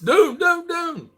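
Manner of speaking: high cute "dune, dune, dune" impression; imitating Chicha. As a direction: mimic the little one pointing with her nose. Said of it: "is what Chicha says".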